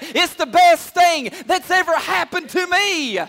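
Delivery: loud, high, fervent testimony; full-force preaching. Real, not acted. Preacher testifying to church what God did, full-force preaching. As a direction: build to a ringing, shouted climax.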